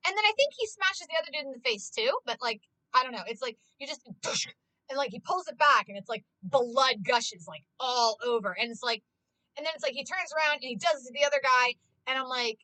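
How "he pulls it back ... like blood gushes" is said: normal talking voice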